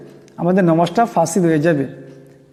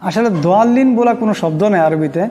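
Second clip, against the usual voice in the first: normal talking voice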